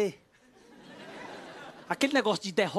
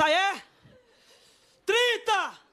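high, loud comeback; challenging bid shout; 9 semitones higher, 3 dB louder, much slower than usual. Retold or imitated. Rival host outbidding the comedian, high shout. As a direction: fire back with a holler.

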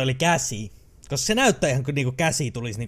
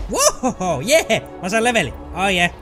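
excited cheering; 5 semitones higher, 5 dB louder, slower than usual. Real, not acted. Celebrating level up.

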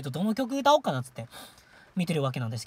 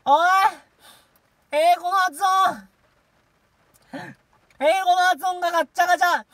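high, loud, rapid heckle; comic self-scolding shout; 7 semitones higher, 8 dB louder, much faster than usual. Real, not acted. Streamer mocking own English pronunciation, comic shouting. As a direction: yell a tsukkomi at yourself.

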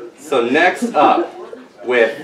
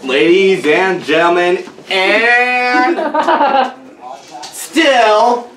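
loud, higher ring announcer; booming pumped announcer; 6 semitones higher, 3 dB louder, slower than usual. Acted. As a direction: hype the crowd like a ring announcer. Announcer hype continues.

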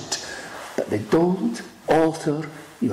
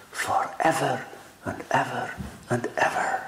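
fading hush; 3 semitones lower, 5 dB quieter than usual. Real, not acted. Preacher fades to hush.

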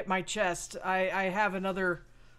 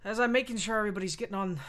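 ordinary delivery

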